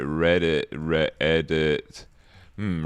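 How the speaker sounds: ordinary talk, nothing marked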